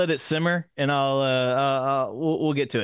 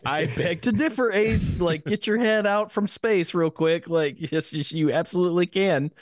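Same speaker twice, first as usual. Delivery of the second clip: higher, scoffing retort to Ace; a high, cheeky comeback; mock retort; 5 semitones higher than usual. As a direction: talk back to Ace, higher and mocking. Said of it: "I was like".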